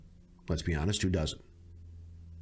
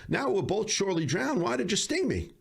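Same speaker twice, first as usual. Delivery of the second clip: higher-pitched, lightly acted frog voice; dismayed frog; 9 semitones higher than usual. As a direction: voice a stung frog's baffled protest, pitched up. Stung frog asks scorpion why, in fable.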